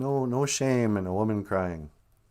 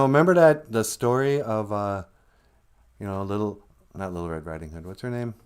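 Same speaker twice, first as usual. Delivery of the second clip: ordinary talk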